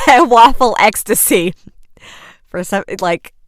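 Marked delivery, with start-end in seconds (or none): 0.0-1.5 laughing. Real, not acted. Laughing at her own bliss.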